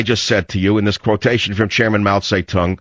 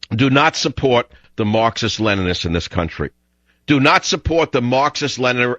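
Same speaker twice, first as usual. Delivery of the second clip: ordinary talk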